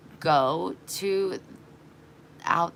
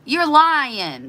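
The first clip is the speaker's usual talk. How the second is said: inner retort; 9 semitones higher, 9 dB louder, much slower than usual. Retold or imitated. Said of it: "but I didn't say anything".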